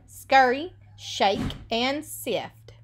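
ordinary talk

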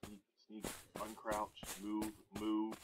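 very soft, low stealth murmur; hushed sneaking. Real, not acted. Player sneaking past walkers, hushed. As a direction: keep it tiny and low, creeping along. Creeping past zombies in game.

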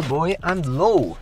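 ordinary talk, nothing marked

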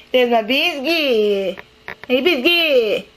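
high, slow sing-song coo to a dog. Real, not acted. Greeting her dog Biscuit in high slow baby talk.

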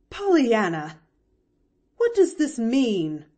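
loud, alarmed call up to the roof; Aunt Polly, startled, calls out to the girl; crying out. A narrator's reading. Aunt finds the girl on the roof, loud cry. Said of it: "cried Aunt Polly then".

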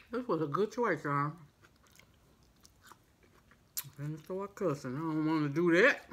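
talking with mouth full; muffled chewing speech; chewing food. Real, not acted. Seen 2: Holds coney, bites at -9.0, mouth working through labelled words.

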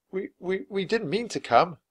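high, stumbling excuse; acted flustered boy, high voice; nervous stammer. A narrator's reading.

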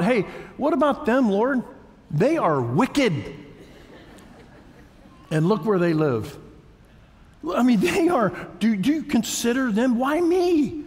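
high, rising complaint to God; indignant protest. Acted. Sufferer complaining to the Lord about the wicked, indignant protest. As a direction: climb to a high, aggrieved cry.

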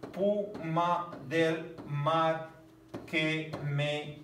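very slow, syllable by syllable; slow syllable reading. Shown as a demonstration. Teacher scanning a verse aloud for students, slowly. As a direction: stretch each syllable to count it. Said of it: "sin alefa".

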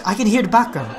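ordinary talk, nothing marked

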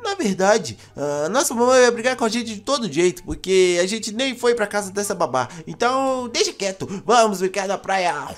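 usual speaking voice

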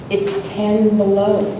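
ordinary talk

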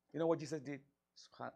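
ordinary delivery

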